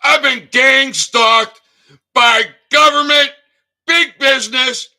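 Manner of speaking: high, loud furious yelling; triggered yelling. Real, not acted. Ranting at the governor and her husband, furious yelling. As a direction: let the rage explode at those who stalk you. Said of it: "yeah, I got triggered".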